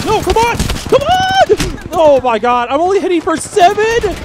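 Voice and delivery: high loud frustrated yell. Real, not acted. Gamer yelling in frustration at weak shots. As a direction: shout in disbelief at the damage.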